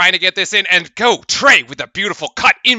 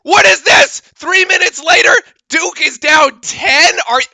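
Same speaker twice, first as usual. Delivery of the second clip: higher, louder disbelieving shout; outraged disbelief; 8 semitones higher, 5 dB louder than usual. Real, not acted. Fan commentator seeing Duke down ten, shouting in disbelief. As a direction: yell at the team in shock.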